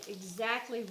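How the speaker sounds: usual speaking voice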